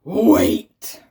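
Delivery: loud far-off menacing call; scary voice. A narrator's reading. Stink bug halting the wedding, scary loud call. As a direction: boom it to stop the wedding. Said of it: "said a scary voice".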